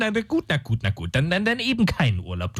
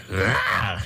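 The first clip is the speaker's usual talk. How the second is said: mock roar; 9 semitones lower than usual. Dämon gibt furchteinflößend an.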